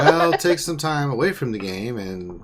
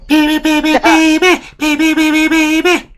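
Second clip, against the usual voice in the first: excited high cheering; 8 dB louder than usual. Real, not acted. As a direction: squeal it out, cheering loudly. Cheering the player on.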